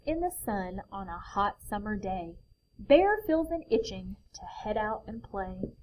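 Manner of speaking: lively picture book reading; reading to children. A narrator's reading. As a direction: read it like a bedtime story.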